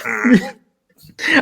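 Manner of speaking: high, knowing hum; mock hum. Host voicing a wrestler's smug high hum. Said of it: "He just like".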